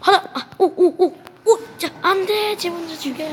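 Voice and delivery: high, loud panicky yelps; frantic excitement. Real, not acted. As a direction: yelp through a frantic fight. Frantic fight. (다급한 흥분)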